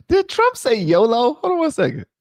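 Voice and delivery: can't believe what he just heard; shocked high-pitched double take; surprised. Real, not acted. Hears Trump apparently say yolo.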